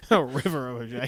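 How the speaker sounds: laughing through the words. Real, not acted. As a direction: repeat it while cracking up. Repeating funny movie line.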